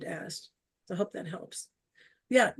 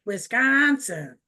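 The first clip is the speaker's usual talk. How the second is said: drawn-out Wisconsin accent, stretched middle vowel; 4 dB louder, much slower than usual. Shown as a demonstration. Showing off her home accent to the group, Wisconsin accent. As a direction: say it slowly in a thick Wisconsin accent. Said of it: "see if I can get the accent".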